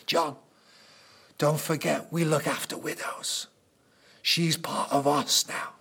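a soft aside, not a real whisper. Retold or imitated. Jesus quietly tells John to care for the widow, hushed. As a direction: lean in and murmur it gently. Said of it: "he whispers to John the Beloved".

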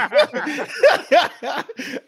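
high burst of laughter; loud laugh. Real, not acted. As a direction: laugh hard. Laughing at his own French.

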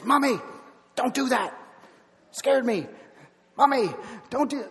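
high-pitched imitation of a scolding toddler; berating little girl. Two-year-old berating mommy for ten minutes, berating girl's voice. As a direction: mimic a child nagging in short high bursts. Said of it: "just berating".

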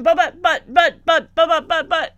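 loud, high string of protests; sputter, trying to cut in; imitated sputtering. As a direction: stammer it repeatedly as a mock objection. Imitating Dexter's ignored protests.

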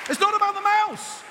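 emphatic proclamation. Real, not acted. Preacher driving the lesson home, high and emphatic. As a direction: proclaim it at a high, forceful pitch.

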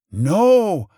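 high, loud cry of refusal; loud, high-pitched witch in danger; witch's alarmed cry. A narrator's reading. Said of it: "cried the witch".

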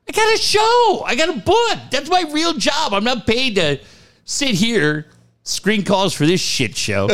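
high-pitched, fed-up protest; exasperated rant. Retold or imitated. Screener snapping back at Ted, high exasperated rant. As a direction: vent like an overworked call screener. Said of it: "retold reply to Ted".

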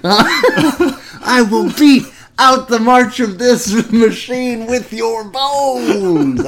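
high, loud, hammed-up villain rant with laughs; shrill gloating wrestling manager. Ranting villain bit at Boston fans.

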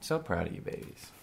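hushed make-believe parental pride; tender mock parent. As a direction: coo it softly to your pretend babies. Pretend parent praising future kids.

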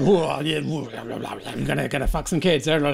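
low, mumbled blah-blah imitation; mock creep. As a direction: slur through a leering man's babble, low. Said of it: "And he's like".

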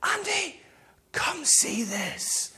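urgent, amazed whisper-call; slow, secretive stage whisper; hushed summons. Retold or imitated. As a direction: beckon softly so the children won't notice. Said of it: "Elizabeth will call me in. She'll go".

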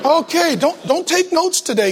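put-on awkwardly steer the children away; mock flustered parent. Parent warning kids off notes, mock flustered. Acted parent covering for guest speaker.

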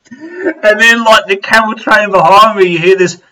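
normal talking voice

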